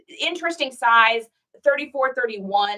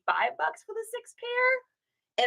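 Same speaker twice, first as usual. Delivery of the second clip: sweet lowball offer; 13 semitones higher, 5 dB quieter, slower than usual. Retold or imitated. Haggling lowball, quoted self.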